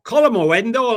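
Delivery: a hammed-up Scottish Calamawendo; Scottish accent. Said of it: "can you say Calamawendo in a Scottish accent?".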